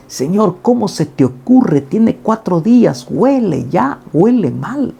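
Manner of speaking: high, slow voice of Marta's protest; a high, pleading Marta. Acted. Marta protests Jesus in sermon.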